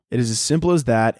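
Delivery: normal talking voice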